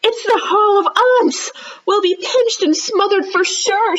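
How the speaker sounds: high loud panicked cry; crying out in alarm. Acted. Rhonda cries out about the aunts, high and loud. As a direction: shriek the warning in dread. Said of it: "she cried".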